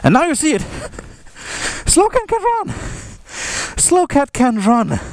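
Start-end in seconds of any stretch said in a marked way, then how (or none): 1.9-2.7 high squeaky voice. Pretend. Announcing a slow cat.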